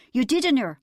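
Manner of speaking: high, loud mock agreement; husband sneering at his wife's boast; sarcastic. A narrator's reading. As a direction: mockingly agree before the denial. Husband mocks wife's claim.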